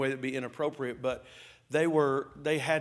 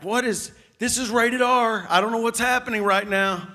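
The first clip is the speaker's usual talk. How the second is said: higher, louder disbelieving cry; shocked outburst; 6 semitones higher, 5 dB louder than usual. Retold or imitated. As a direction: blurt it out, stunned at the scene. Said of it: "I'm like".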